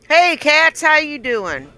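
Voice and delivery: loud high call out; loud greeting. Real, not acted. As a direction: call out a big hello.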